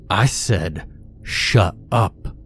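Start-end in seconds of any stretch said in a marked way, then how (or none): 1.2-2.4 forceful command, barely raised; commanding father, understated force; godlike force. A narrator's reading. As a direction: order it with contained power. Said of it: "He spoke again with that godlike force".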